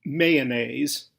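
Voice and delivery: Mayonnaise in three syllables, "MAY-uh-nayz"; proper pronunciation. Shown as a demonstration. Narrator introducing the next word, Mayonnaise pronounced "MAY-uh-nayz". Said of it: "Proper pronunciation is".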